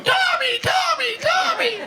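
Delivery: very high shrieked calling of a name; hammed-up girlfriend screaming for her boyfriend; mock screaming girlfriend. Girlfriend screaming for Tommy in the water, mock shriek. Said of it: "Denise was going".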